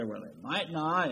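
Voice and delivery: soft, unsure mock hedge; hedging. Preacher mocking a doubter, softly hedging. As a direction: shrug it off as a maybe. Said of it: "that's emphatic language".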